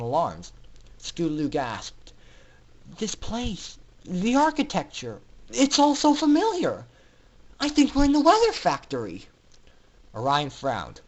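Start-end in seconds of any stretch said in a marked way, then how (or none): 3.0-9.3 higher, rising amazement; stunned realization. Acted. Scootaloo realizing where the carriage brought them, astonished. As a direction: it dawns on her, voice lifting.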